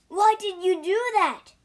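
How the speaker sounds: high, loud cry of protest; loud, high-pitched demand; dismayed cry. A narrator's reading.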